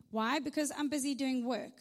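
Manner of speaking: ordinary delivery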